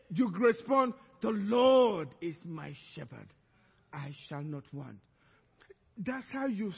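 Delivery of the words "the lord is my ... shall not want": solemn recitation. Acted. Believer answering the bills worry with scripture, low and solemn.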